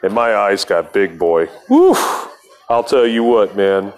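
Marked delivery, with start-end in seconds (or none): 1.7-2.3 high-pitched "woof" of amazement; impressed high exhale; amazed whoop. Retold or imitated. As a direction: let out a high, airy whoop. Reacting to the big news.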